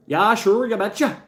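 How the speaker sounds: "sure" stretched, go big and sing-song like a caricature; hammed-up Minnesota accent. Riffing for chat, a hammed-up high Minnesota accent.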